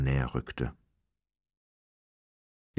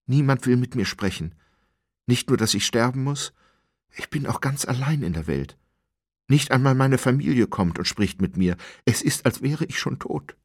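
lament; 7 semitones higher, 4 dB louder than usual. A narrator's reading. Abandoned prisoner laments.

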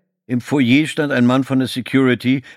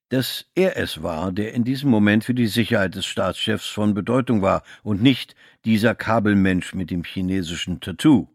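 plain reading voice

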